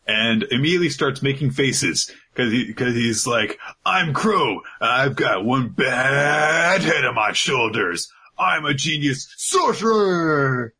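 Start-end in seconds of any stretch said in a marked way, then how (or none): 3.8-10.7 hammed-up braggart, higher with drawn-out words; cocky show-off proclaiming his genius; boastful Chrome. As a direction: crow it grandly and stretch the key words. Acting out boastful character Chrome.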